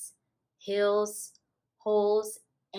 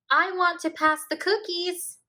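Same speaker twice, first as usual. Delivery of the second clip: put-on high, loud little boy; eager little kid asking; child voice; 10 semitones higher, 7 dB louder than usual. A narrator's reading. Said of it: "George said".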